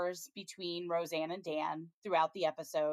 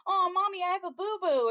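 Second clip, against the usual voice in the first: put-on much higher and louder, a hurt toddler's whimper; whiny little boy; 13 semitones higher, 5 dB louder than usual. Little boy whining to his mom about his boo-boo. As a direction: squeak it out like a little kid with a scrape. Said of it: "And he's like".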